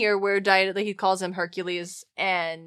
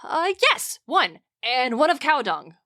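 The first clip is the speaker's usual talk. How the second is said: Xanthias, the sly slave, teasing. Acted. Reading Xanthias to the frightened Dionysus, hammed-up and excited. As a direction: play the servant spooking his master for fun.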